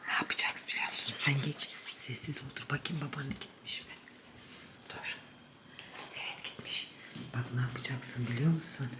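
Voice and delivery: unvoiced whisper; whispering while hiding. Real, not acted. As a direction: whisper so dad won't hear. (saklanırken fısıltı)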